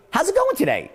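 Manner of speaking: GOING lifted high, as if you really want to know; high, keen "How's it going today?", stress on GOING; caring uptone greeting. Shown as a demonstration. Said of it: "So when I say".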